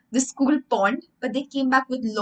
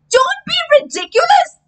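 high, loud outraged teacher cry; shrill cry; 8 dB louder than usual. Acted. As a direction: cry out in high scandalised disbelief. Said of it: "cried Miss Corbishley".

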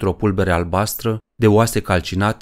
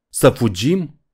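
mildly raised, higher-pitched cry; shouted question; 4 semitones higher, slower than usual. A narrator's reading. Poe calling into the wind at talk of fleeing, mild shout. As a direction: call it out in disbelief, only half shouted. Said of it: "strigă Poe în vânt".

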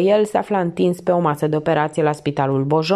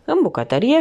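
usual speaking voice

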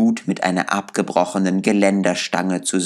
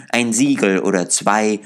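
usual speaking voice

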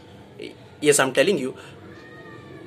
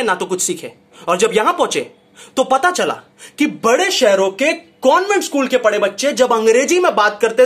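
ordinary talk, nothing marked

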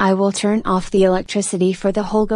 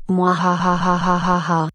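evil laugh. Acted. Villain laughs after blackout.

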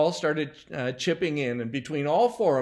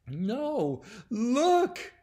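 put-on upset little boy; 7 semitones higher than usual. A narrator's reading. Timmy showing Susan what he holds, upset. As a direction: blurt it out in a high child's voice.

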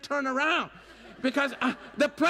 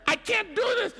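panicky; 9 semitones higher than usual. Retold or imitated. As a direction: beg off in a panic. Panicking at the elevator door.

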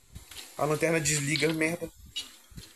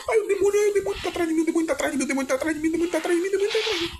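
high panicked repeating; frantic, terrified chant; 10 semitones higher, much faster than usual. Real, not acted. Monster chasing him.

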